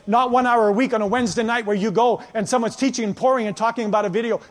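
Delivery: normal talking voice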